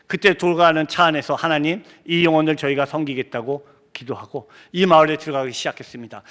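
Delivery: ordinary delivery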